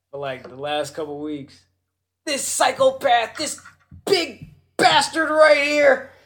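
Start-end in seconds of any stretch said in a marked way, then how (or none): 2.2-6.1 slow, high, loud promo shout; Dolph Ziggler. Retold or imitated. Podcaster imitates wrestler boasting of his henchman, loud promo. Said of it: "Dolph Ziggler has been calling".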